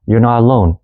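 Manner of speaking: usual speaking voice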